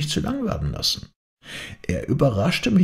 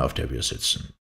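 ordinary delivery

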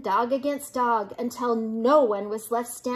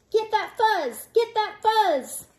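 high, urgent chant; mob cry; 8 semitones higher, 3 dB louder than usual. A narrator's reading. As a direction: urge the mob on, pitched up. Mob fighting over the fuzz.

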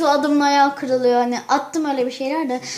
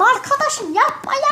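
higher-pitched mock outburst; exaggerated annoyance; 8 semitones higher, slower than usual. Girl hams up her anger at her friends, high-pitched. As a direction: overdo the irritation, squeaky and high. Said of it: "birazcık abarttım".

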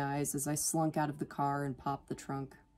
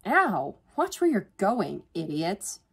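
loud, higher irritated outburst; growled; 4 semitones higher, 5 dB louder, slower than usual. A narrator's reading. Student crashing into a stranger, growling. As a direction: lash out, falling back on the insult. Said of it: "I growled".

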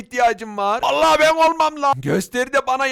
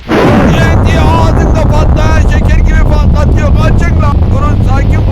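yelling over explosions at full volume; the magician shouting at villagers to come back; frantic shouting; 3 semitones higher, 12 dB louder than usual. Acted. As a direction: bellow as the TNT goes off in your mouth. Shouting through exploding TNT.

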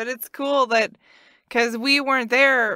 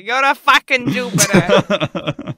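put-on Boston accent; 4 semitones lower, 6 dB louder, slower than usual. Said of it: "that's how Dad would do it".

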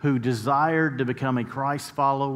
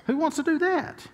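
whiny complaint. Retold or imitated. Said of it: "I said".

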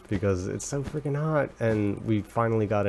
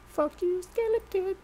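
high, mocking jeer at the skeleton; playful high taunt; 4 dB quieter than usual. Real, not acted. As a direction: sneer at the beaten enemy in a squeaky voice.

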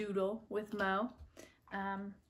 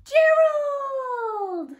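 bright high piggy voice calling out; excited high Piggy call; 17 dB louder than usual. Acted. Piggy greeting Gerald, high excited call. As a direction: call your friend's name with joy.

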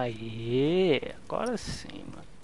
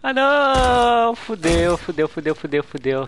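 panicked shout; 9 dB louder, slower than usual. Real, not acted. Stepping on a bomb.

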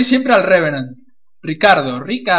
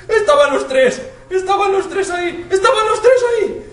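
high laughing relief; 13 semitones higher than usual. Real, not acted. Laughing after the scare.

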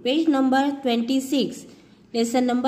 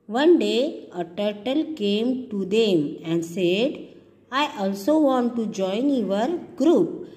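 story narration; 3 semitones lower, slower than usual. Acted. Teacher reads story aloud.

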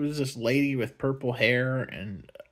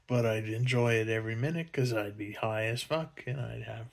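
usual speaking voice

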